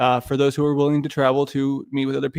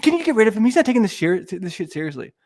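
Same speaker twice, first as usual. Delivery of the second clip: higher, put-on whiny voice quoting Paul; mocking Paul; 7 semitones higher than usual. Streamer mocking Paul's complaint, high put-on voice. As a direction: mimic Paul begging to get rid of him. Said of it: "Paul was like".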